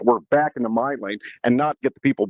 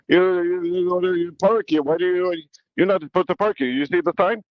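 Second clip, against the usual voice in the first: put-on old man's European accent; 7 semitones higher than usual. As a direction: play the fussy old man scolding in his accent. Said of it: "a little European accent".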